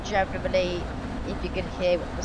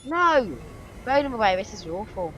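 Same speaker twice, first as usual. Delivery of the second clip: dismayed cry; 3 semitones higher than usual. Real, not acted. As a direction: cry out in protest, pitched up. Dismayed gamer exclaiming at the game.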